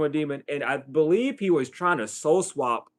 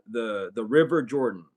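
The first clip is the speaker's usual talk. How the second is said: ordinary delivery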